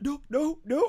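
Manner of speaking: high, pleading no's in mock despair; mock anguished no. Friend imitating his reaction to the dragon dying, a high mock wail. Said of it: "you were like".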